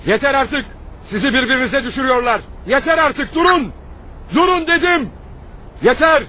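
shouting to stop a fight. Acted. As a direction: yell at them to stop. Stopping a brawl in the street.

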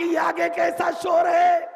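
high, loud ringing shout; crying out. Acted. Zainab calls out about the noise ahead, crying out. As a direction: shout the question out, pitched high. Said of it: "چیخ کے کہا کہ".

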